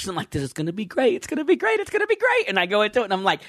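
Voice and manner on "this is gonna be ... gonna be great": each repeat climbing much higher; pumping himself up before a show he expects to love; building excitement. Retold or imitated. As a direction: hype yourself up more with each line. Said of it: "I pump it up too much".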